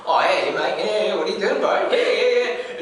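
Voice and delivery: high, loud Kiwi bloke voice; New Zealand accent. Retold or imitated. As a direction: play a cheeky Kiwi kid, laughing. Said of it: "some New Zealand kid go".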